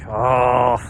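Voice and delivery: mock passive-aggressive grumble. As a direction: complain with a loud put-on sigh, then lift the pitch. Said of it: "passive aggressively".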